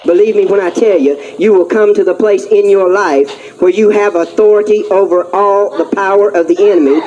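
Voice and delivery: ordinary delivery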